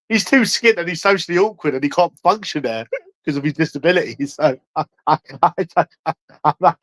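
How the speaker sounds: talking through giggles; helpless chuckling between broken phrases; cracking up. Real, not acted. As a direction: laugh so much the words stall and stutter. Cracking up at his own mockery.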